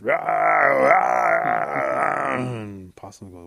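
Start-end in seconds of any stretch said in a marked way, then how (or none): 0.0-2.4 loud, drawn-out crowd roar; long howling yells; roaring brawl noise. A narrator's reading. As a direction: imitate a roomful of fighting. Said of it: "all you hear is this".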